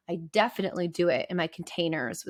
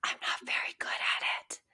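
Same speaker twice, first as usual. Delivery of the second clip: whispered confession, barely voiced; quietly; 8 dB quieter than usual. Real, not acted. Therapist admitting to listeners she struggles, whispering. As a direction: breathe it out like a secret you hate admitting. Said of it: "do you notice I have to say that, like, quietly".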